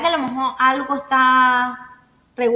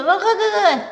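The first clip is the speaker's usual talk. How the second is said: put-on higher, light baby cooing; baby babble; 6 semitones higher, 3 dB louder than usual. Teacher imitating a baby answering an adult, high babble. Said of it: "ellos dicen".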